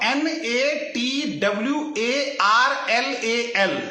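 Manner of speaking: letter by letter, very slow; a mock-solemn spelling of Natwarlal. Shown as a demonstration. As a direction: spell each letter out deliberately. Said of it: "Natwarlal की spelling मैं बताता हूं".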